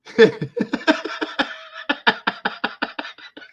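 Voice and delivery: high, wheezing laugh running out of voice; helpless laughter. Real, not acted. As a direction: laugh until only a wheeze is left. Laughing fit at own thought.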